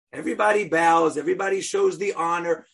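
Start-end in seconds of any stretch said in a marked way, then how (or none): none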